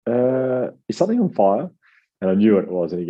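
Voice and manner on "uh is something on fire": much higher, rising, as if sniffing something wrong; a surprised neighbour asking, pitched way up; mock alarmed question.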